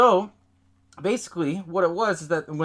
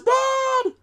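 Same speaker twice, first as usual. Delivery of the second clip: put-on very high, loud child's call; drawn-out mock kid yell; son calling out; 5 dB louder than usual. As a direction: holler for a parent like a kid. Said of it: "his son comes out".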